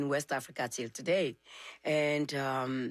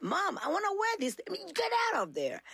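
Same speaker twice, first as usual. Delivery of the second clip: put-on high pleading child; little girl voice; 12 semitones higher than usual. Little girl begging her mom for the costume, high childish plea. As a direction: beg like a small girl. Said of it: "and I'm like".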